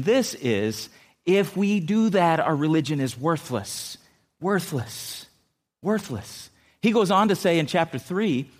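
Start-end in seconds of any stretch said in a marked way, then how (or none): 2.4-6.5 slow, falling "Worthless." pressed home; stress on worthless. Real, not acted. Preacher drives home the verse, slow and heavy. Repeated emphasis.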